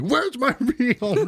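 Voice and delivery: high, drawn-out mock yell; retold yell. Son yelling at mom in a dream, mock yell. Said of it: "yelled at my mom".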